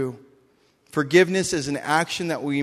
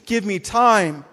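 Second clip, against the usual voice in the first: pleading servant; 5 semitones higher, 3 dB louder than usual. Retold or imitated. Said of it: "He's like".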